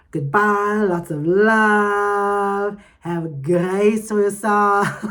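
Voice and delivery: cheerful farewell to the listeners; bubbly sign-off. Real, not acted. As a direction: sing out the goodbyes.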